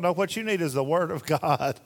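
normal talking voice

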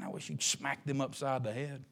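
ordinary talk, nothing marked

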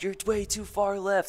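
high, drawn-out mock yell at normal volume; retold yell. Caller yelling to the helicopter pilot on the phone, retold yell. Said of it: "I'm yelling to the pilot".

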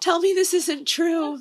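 high-pitched, mock-distressed plea; retold dismay. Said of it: "I was real sad about it".

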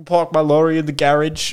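put-on British accent. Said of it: "British accent the entire time".

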